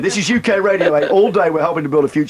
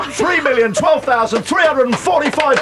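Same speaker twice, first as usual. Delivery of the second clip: ecstatic high-pitched yelling; triumphant shout; 4 dB louder than usual. Real, not acted. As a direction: scream out the big news. Announcing record charity total.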